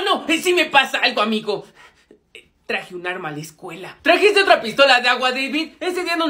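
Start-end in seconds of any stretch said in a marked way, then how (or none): 2.3-4.0 hushed confession. Acted. Confessing a secret.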